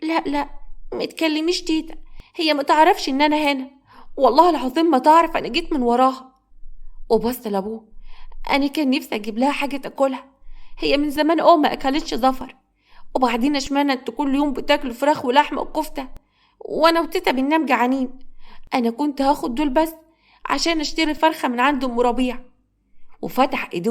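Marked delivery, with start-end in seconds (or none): 0.0-6.3 put-on higher, pleading child voice; small, distressed child swearing innocence; weeping boy. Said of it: "عمر كان واقف وبيبكي". 8.5-22.4 put-on higher, plaintive child voice; small, hurt little boy; pleading young boy.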